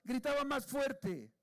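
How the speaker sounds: high, loud and drawn out; slow, ringing preacher's shout; emphatic shout. Retold or imitated. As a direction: raise the voice to match the shouting described. Acts out Bartimeo shouting louder.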